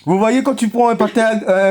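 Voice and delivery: ordinary talk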